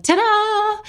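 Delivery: high, long-held singsong flourish; mock ta-da. Mocking an easy diagnosis story, singsong fanfare. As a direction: sing it out like a magic reveal.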